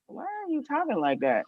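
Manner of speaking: high, soft puzzled question; puzzled interviewers. Retold or imitated. Interviewers puzzled by her stumbling answers, high voice. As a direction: ask it high and baffled. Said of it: "they're looking at me like".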